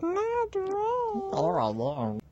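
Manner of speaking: very high, slow whiny wail; squeaky crying voice. Acted. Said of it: "What was that?".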